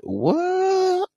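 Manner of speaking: high squeaked disbelieving what; incredulous what. Retold or imitated. Mocking a guest, squealed high in disbelief. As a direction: shriek it like you can't believe it. Mock disbelief at merch claim.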